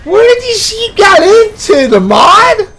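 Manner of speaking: high, loud, crying disbelief; shocked outcry. Real, not acted. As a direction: shout it like you can't believe your eyes. Sheep vanish unexpectedly.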